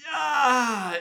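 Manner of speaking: high, drawn-out mock cheer; fans cheering. Fans reacting to the song title, high mock cheer. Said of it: "They're like".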